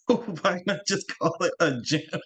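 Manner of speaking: laughing disbelief. Real, not acted. Mocking the fancy gym name.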